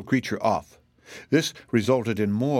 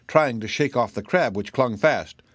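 plain reading voice

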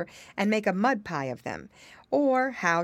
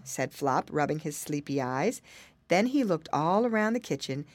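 usual speaking voice

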